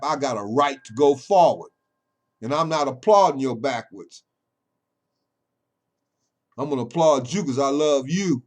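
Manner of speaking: loud, high, combative preacher delivery; full-force preaching. Acted. Preacher defending his right to go forward, preaching at full force. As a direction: fire it back at the critics. Fights back at critics, preaching.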